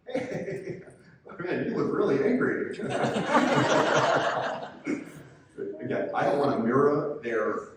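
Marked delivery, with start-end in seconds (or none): 0.1-2.8 chuckling, higher, a wrong-way laugh; laughing at the coach. Pretend. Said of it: "The last thing we want to do is just go". 2.8-5.3 breathy high chuckle at the coach; airy high laugh. Pretend. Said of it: "The last thing we want to do is just go".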